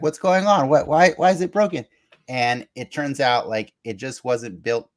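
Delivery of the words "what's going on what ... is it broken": higher and louder mock panic, imitating confused villains; mock alarmed cry. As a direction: play it up as a baffled shout, higher and louder. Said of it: "And they're like".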